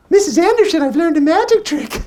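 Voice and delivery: put-on high, bright little boy voice; eager kid voice telling his teacher; child self. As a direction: squeak it out like an excited fourth grader.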